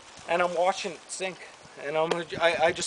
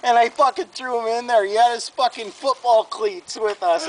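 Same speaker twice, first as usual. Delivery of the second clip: usual speaking voice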